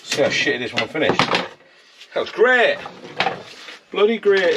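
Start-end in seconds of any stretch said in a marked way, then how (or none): 1.2-4.6 high, slow, drawn-out praise; admiring. Real, not acted. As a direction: stretch it out, pleased with himself. Admiring own work.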